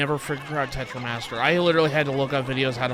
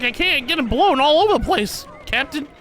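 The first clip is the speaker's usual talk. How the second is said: high, loud little minion voice; Pikmin voice; 13 semitones higher, 6 dB louder than usual. Pretend. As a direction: squeak it like a tiny crewman. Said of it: "He's like".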